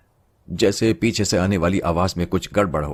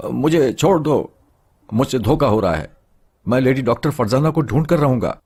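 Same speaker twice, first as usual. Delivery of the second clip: struggling shout; 5 semitones higher, 3 dB louder than usual. A narrator's reading. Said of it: "तिलमिला रहा था ... कह रहा था".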